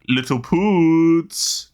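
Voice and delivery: slow, drawn-out, high cartoon voice; big cartoon man voice. Remembering a cartoon scene, a big man's drawn-out voice. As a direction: stretch it out like a big cartoon guy. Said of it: "really big man in Rocko's Modern Life saying".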